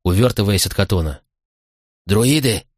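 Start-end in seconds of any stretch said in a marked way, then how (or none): 2.1-2.7 mild acted cry of alarm; understated shout; warning shout. A narrator's reading. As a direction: call out the warning, no real volume. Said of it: "выкрикнул Прозутак".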